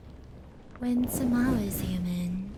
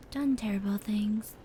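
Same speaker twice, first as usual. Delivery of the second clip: usual speaking voice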